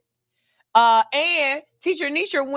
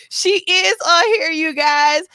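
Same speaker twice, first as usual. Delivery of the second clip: excited cry; 6 semitones higher, 5 dB louder than usual. Real, not acted. Star is in her chat.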